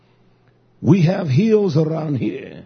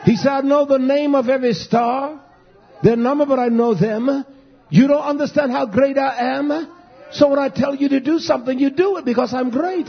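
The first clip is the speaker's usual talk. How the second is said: higher, driving revival preaching; preaching at full force; 5 semitones higher than usual. Acted. Preacher calling the wicked cast down, fervent preaching. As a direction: drive it home to the pews.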